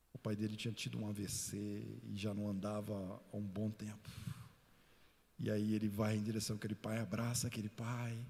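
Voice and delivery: ordinary talk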